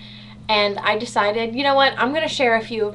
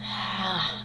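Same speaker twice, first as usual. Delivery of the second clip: deep relieved sigh; 4 semitones lower, 10 dB quieter than usual. Real, not acted. After using inhaler.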